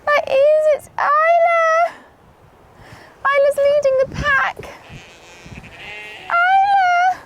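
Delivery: very high, loud cooing call; calling to animal. Real, not acted. Owner greets Isla leading the pack, high excited calling. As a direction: coo loudly across the field.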